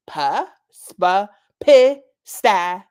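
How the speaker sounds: pa, spa, pistachio built up and ending loud and slow; syllable by syllable. Acted. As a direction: sound out pistachio syllable by syllable, rising. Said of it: "stressed each syllable".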